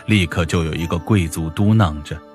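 ordinary delivery, nothing marked

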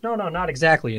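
lightly voiced producer cutting in; producer correcting. Producer correcting the writer's guess, retold objection. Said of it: "the producer goes".

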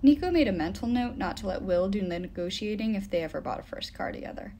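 ordinary delivery

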